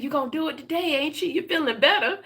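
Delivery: a hint of a fussing grandma. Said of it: "she said".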